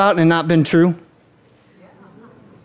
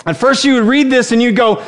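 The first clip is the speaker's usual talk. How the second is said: normal talking voice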